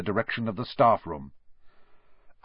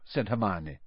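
ordinary delivery

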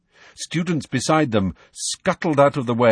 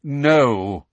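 a short firm sharp no; 4 semitones higher, 4 dB louder than usual. Acted. Dumbledore stopping Trelawney from leaving, sharp and firm. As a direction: cut her off firmly. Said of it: "said Dumbledore sharply".

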